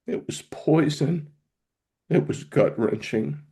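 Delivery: slow, high, choked with real pain; anguished. Real, not acted. Patient remembering his goodbye calls, anguished. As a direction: let each word drag out, close to tears. Recalling the worst night.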